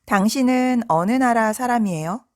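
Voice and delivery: normal talking voice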